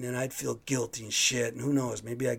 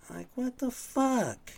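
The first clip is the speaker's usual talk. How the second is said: much higher, slow, stunned disbelief; incredulous; 12 semitones higher, slower than usual. Real, not acted. Reacting to her text blaming him, slow high disbelief. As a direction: let the outrage drag out high.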